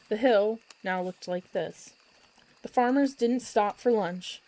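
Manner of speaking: usual speaking voice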